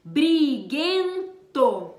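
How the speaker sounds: Briguento said slowly, every sound clear; slow example word. Shown as a demonstration. Teacher models Briguento slowly for the class, drawn out. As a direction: draw out Briguento so the B and R both ring. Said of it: "Veja só alguns exemplos".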